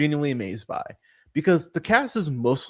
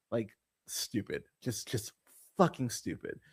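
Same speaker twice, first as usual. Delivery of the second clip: disgusted mutter; 5 semitones lower, 11 dB quieter than usual. Real, not acted. Disgusted verdict on the victim.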